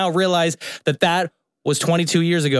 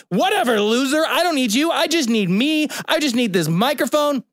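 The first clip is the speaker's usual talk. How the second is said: high-pitched, playful brush-off; mock-defiant retort; 8 semitones higher than usual. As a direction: retell it as a hammed-up dismissal. Retells defiant retort to absent friend.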